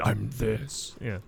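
low, hushed disguise voice; Batman. Acted. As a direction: drop it low and quiet to hide who you are. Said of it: "I don't know where he got that voice from".

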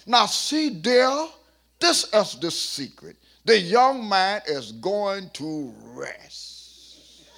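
slow, drawn-out knowing remark; lightly voiced old man, savoring it; old preacher to wife. Gardner Taylor telling his wife the secret, lightly voiced.

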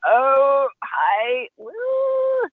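high, dazed hello into a squeaky woo; mock starstruck greeting. Meeting an investor from her idol's deal, mock starstruck. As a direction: greet him high, as if blown over. Star-struck, blown over, retold.

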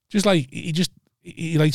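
ordinary delivery

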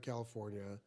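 usual speaking voice

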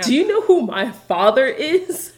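put-on spoiled rich-boy sneer; dissolute young heir. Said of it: "It's Lucius Malfoy is".

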